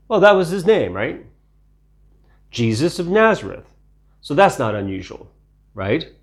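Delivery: ordinary talk, nothing marked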